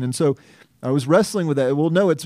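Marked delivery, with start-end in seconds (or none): none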